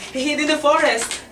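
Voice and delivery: plain reading voice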